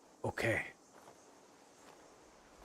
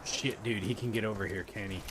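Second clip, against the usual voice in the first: normal talking voice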